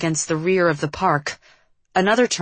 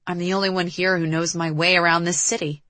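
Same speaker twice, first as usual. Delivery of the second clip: plain reading voice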